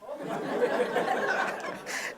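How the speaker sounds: airy, silent giggle; wheezing laugh at the story; high breathless laugh. Real, not acted. Laughs after mother hangs up.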